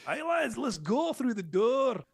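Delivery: high, slow Scottish accent, hammed-up; cheery Scottish dwarf accent. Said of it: "not gonna get me to do a Scottish accent".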